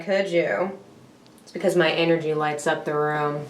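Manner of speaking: usual speaking voice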